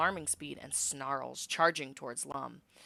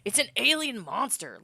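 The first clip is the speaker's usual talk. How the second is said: louder, higher snarl of disgust; 7 semitones higher, 8 dB louder than usual. Acted. Governor denounces the alien to the crowd, snarling. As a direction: spit it out at the creature. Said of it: "Lum snarls".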